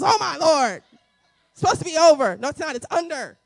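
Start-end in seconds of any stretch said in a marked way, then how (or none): 0.0-2.4 mock exasperation. Acted exasperated listener.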